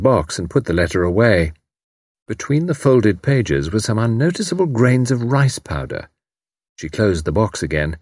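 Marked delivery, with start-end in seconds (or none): none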